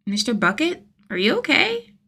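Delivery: put-on very high small child voice; anxious little boy checking; worried kid. Kid's worried question.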